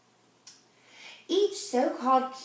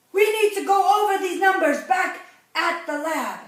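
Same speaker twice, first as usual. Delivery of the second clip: loud, higher, rushed expert voice; hurried doctor shout; 5 semitones higher, 6 dB louder than usual. Acted. Doctor tells the family they're leaving, half-shouted. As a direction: call it out while hurrying away.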